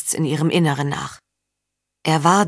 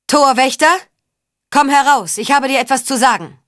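lautes Rufen; 8 semitones higher, 5 dB louder than usual. A narrator's reading. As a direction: call up to the battlements, high and loud. Ruft den Torwächter auf den Zinnen. (nach oben zum Torwächter rufen)

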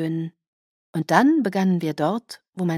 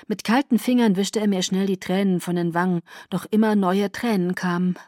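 usual speaking voice

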